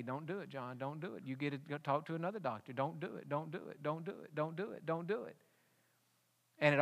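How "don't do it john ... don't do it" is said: soft, hushed inner voice pleading; hushed Spirit urging don't do it; Holy Spirit voice. Retold or imitated. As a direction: murmur it like a still small voice. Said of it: "the Holy Spirit is saying to me".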